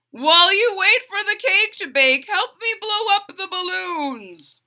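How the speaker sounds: cheerful dad asking for help; father's voice. Acted.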